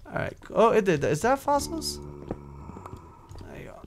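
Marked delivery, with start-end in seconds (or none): 2.0-3.8 whispered under the breath; whispering. Real, not acted. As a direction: breathe it out softly. Whispered aside.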